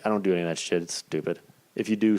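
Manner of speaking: ordinary talk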